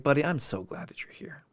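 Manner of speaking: soft, hushed sincere welcome. Retold or imitated. Teacher quietly greets a student who walks in, sincere and hushed. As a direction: speak softly, truly glad he came. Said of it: "the genuine sincerity".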